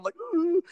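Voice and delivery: high squeaky mock sob, hammed up; mock fake crying. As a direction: mimic a terrible fake cry. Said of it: "the most unconvincing cry ever".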